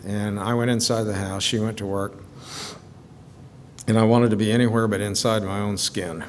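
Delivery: ordinary talk, nothing marked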